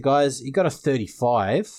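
ordinary talk

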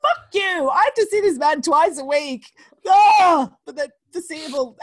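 mock outburst. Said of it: "I was like".

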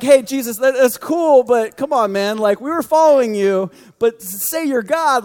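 high, exasperated man-to-man appeal; mock protest. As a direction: protest like an uneasy follower. Disciples protesting to Jesus, acted out.

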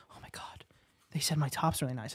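breathless awed whisper. Retold or imitated. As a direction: whisper it like a gullible fan. Mocking gullible person, whispered.

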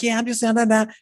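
chirpy hello to your followers; imitating a friend's cheerful Instagram story; upbeat vlogger. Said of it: "She's like".

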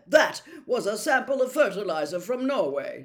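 loud, flustered protest; indignant Percy. A narrator's reading. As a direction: defend yourself, embarrassed. Percy indignant, red in face.

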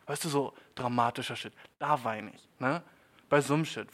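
ordinary talk

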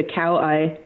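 "cow eye" as two English words; pronounced as cow eye. Shown as a demonstration. Co-host jokingly teaches the host, "cow eye" as two plain words. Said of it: "Pronounce it like that".

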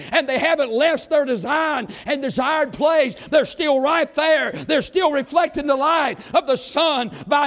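loud, rising preacher's cry; shouting sermon climax; full-force preaching. Acted. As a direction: preach it hard to the congregation.

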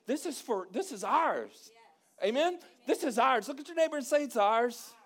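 loud, high fervent preaching. Acted. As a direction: preach it with rising fire. Preaching at full force.

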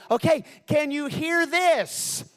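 high, loud, slow imitated contestant; hammed-up shrieked question; excited yell. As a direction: holler it eagerly down the phone. Contestant yelling before breaking glass.

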